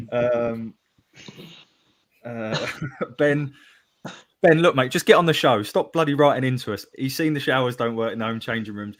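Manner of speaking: ordinary talk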